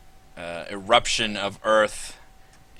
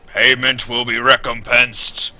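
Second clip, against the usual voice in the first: booming robot voice; 4 semitones higher, 9 dB louder than usual. Acted. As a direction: boom it out like a steel guardian.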